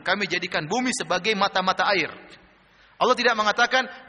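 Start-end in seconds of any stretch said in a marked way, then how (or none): none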